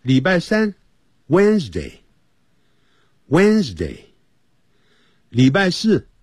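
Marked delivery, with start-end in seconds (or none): 1.3-4.0 Wednesday drawn out slowly; slow model reading. Shown as a demonstration. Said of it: "我们现在就跟着讲".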